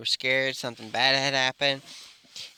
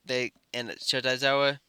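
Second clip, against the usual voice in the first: plain reading voice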